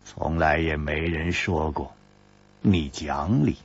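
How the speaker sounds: low, soft, unhurried jab; low lazy deadpan; leisurely dry retort. Acted. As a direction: drop it lazily and quietly. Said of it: "悠悠地说".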